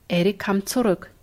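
plain reading voice

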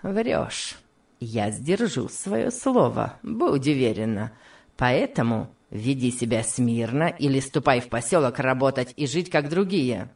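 low, controlled threat; heavy-handed villain, voice dropped low; menacing master. A narrator's reading. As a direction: lay down the law in a low voice. Master threatens defiant woman.

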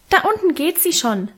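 put-on high-pitched little boy's crow. A narrator's reading. Little brother reports from the house corner, crowing. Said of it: "krähte er".

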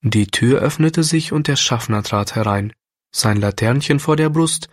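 usual speaking voice

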